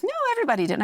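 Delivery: a touch of the aunt digging in; mild mock protest from the aunt; aunt's protest. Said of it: "And she goes".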